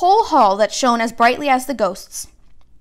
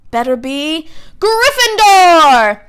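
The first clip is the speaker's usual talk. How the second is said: loud, slow final verdict; triumphant Sorting Hat cry; hat shouting; 6 semitones higher, 5 dB louder, much slower than usual. A narrator's reading. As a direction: burst out the house name. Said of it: "the hat shout the last word".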